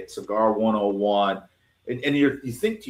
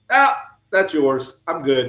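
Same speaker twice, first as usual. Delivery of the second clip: high, slow, louder awkward polite refusal; 6 semitones higher, 5 dB louder, slower than usual. Retold or imitated. Refusing the cutter a homeless man slobbered on, slow high awkward politeness. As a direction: stretch it out, backing away politely. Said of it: "I'm like".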